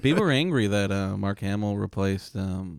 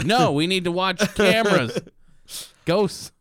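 high, hammed-up urgency; obsessive insistence; 7 semitones higher, 3 dB louder than usual. Obsessed ghost hunter refusing movie night, mock frantic. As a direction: refuse in a pitched-up, worked-up voice. Acted obsessed man overrules partner.

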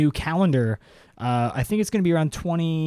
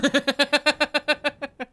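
high giggle; 12 semitones higher than usual. Real, not acted. Host cracking up mid-story, high giggle. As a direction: crack up in a high, rapid laugh.

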